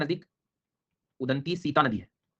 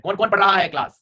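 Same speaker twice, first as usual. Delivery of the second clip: calling out; 5 semitones higher, 7 dB louder than usual. Real, not acted. Teacher calls out to class.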